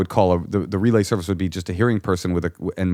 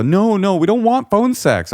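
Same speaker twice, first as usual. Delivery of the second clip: much higher and louder, alarmed no; horrified protest; 12 semitones higher, 5 dB louder, slower than usual. Retold or imitated. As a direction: cry out no in a panic. Callers insisting.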